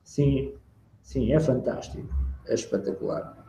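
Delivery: low, soft, lifeless praise; unenthusiastic. Shown as a demonstration. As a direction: say it with no energy, barely voiced. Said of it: "Porque se eu estou a falar assim".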